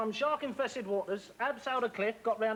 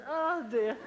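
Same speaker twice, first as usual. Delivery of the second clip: mock oh dear; 6 semitones higher, 4 dB louder than usual. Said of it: "everyone goes".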